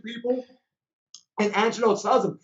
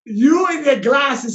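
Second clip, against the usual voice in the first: mock rude snap; 5 semitones higher, 6 dB louder, slower than usual. Repeating the passenger's insult.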